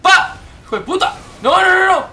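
high loud yells with laughter; laugh-scream through the chaos; frantic laughing shouts. Real, not acted. Player shouting while the boss keeps hitting, laughing.

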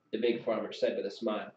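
usual speaking voice

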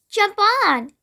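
high, eager storybook call; crying out. A narrator's reading. Ants to the bee by the leaf, high call. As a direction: call out lightly, pitched well up. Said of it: "they cried".

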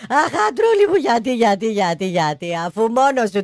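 crying widow. Acted. Pontian widow crying over her husband's coffin. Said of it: "να κλαίει και να μονολογεί".

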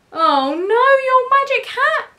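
high, loud storybook wail; dismayed cry. A narrator's reading. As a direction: cry out in alarm at the loss. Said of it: "cried the cats".

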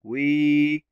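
"وي" as a long, held "wee"; long vowel. Shown as a demonstration. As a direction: stretch out the ee in "وي". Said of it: "صوت طويل بالياء". («وي» بصوت طويل ممدود بالياء)

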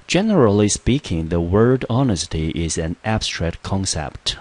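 loud, slow example-sentence read. Shown as a demonstration. Teacher reading the example sentence aloud, slow and clear. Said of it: "那我们看例句".

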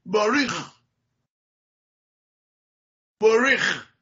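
باروخ said as boriχ. Shown as a demonstration. Teacher demonstrating the Eastern European form. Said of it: "کلمهٔ باروخ شده بوریخ".

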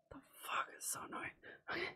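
whispered curse, then a whispered laugh; whispered annoyance. Real, not acted. Annoyed player whispering about the enemies left. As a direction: mutter it to yourself under your breath. Annoyed aside under breath.

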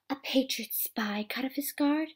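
gasp, then a whispered taunt. Acted. A villain taunting a captured spy in a whisper. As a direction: breathe it out in a sly whisper.

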